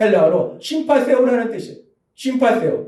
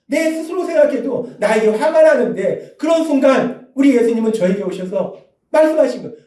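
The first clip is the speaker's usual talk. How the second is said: ordinary talk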